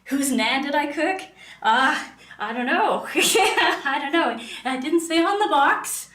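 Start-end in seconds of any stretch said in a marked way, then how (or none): 1.6-6.2 laughing. Real, not acted. Laughing answer to a chat question.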